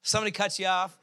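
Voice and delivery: ordinary talk, nothing marked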